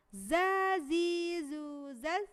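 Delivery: "za, zi, zu, zaz" loud, buzzing on; za recitation. Shown as a demonstration. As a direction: buzz hard on each z. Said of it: "itu sama ya".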